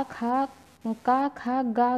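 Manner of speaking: खा, का said very slowly from the throat; teacher sounding out throat letters; slow guttural demo. Shown as a demonstration. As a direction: draw out खा and का like a model for pupils. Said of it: "बोल के बिल्कुल आप लोग".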